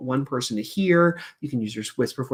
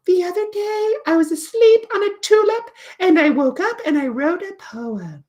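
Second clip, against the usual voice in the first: very high little puppet voice; tiny, high creature telling a tale; Flitter; 6 dB louder than usual. Acted. Puppet tells teacher about her poem, very high. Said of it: "Flitter eventually teaches us".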